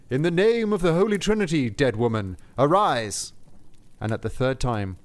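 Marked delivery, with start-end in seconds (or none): 0.1-3.3 louder, raised incantation; solemn command. Acted. Saint Peter to the dead princess, loud solemn command. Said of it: "said three times".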